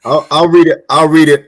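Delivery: loud, laughing no, stop it; loud protest. Real, not acted. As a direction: shout him down, half joking. Stopping a friend's joke reading.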